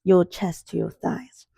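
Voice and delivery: ordinary delivery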